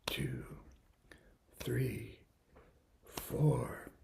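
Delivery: whispered count. Shown as a demonstration. Teacher whispering count to children, whispered. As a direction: breathe the number. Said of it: "Now you clap".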